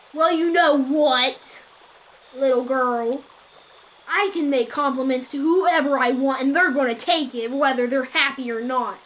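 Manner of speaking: retold furious caller; angry creep. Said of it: "he got kinda mad".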